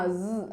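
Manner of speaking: "സ്" voiced as a z; letter sound demo. Shown as a demonstration. Teacher answers how the dotted letter sounds, letter sound demo. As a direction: buzz "സ്" like the z in zed. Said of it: "എന്ന് pronounce ചെയ്യും".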